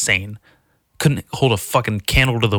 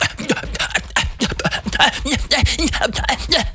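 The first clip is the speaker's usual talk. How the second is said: high, repeating stuck-record stutter; skipping-record stammer. Retold or imitated. People too uppity to talk, skipping stammer. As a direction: imitate a skipping record of frustrated babble. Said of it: "skipping like a reg".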